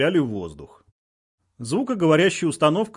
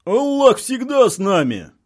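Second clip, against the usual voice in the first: high, loud, drawn-out proclamation; loud rousing declaration; fervent shout; 9 semitones higher, 5 dB louder, slower than usual. A narrator's reading. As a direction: shout it as a fighter's creed. Militants yell back at loudspeaker.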